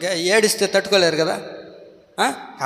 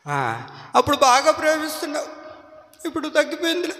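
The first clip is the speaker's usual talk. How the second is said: whining wife; 11 semitones higher than usual. Retold or imitated. Wife voiced complaining.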